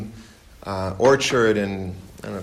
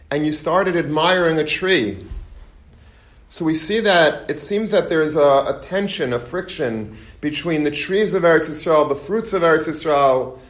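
higher, louder rabbinic preaching voice; 5 semitones higher, 4 dB louder than usual. Acted. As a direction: build the Torah lesson with fervour. Raises voice for the Torah teaching.

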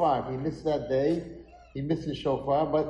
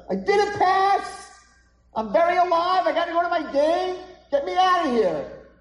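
put-on frantic protesting boy; 8 dB louder than usual. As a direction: scream in acted outrage to be heard. Boy protests being declared dead.

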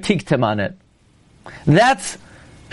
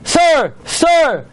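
high, louder mock yell; imitated shouts, pitched way up; screaming driver; 9 semitones higher, 3 dB louder than usual. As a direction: call out like an insistent trolley driver. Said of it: "the driver screaming".

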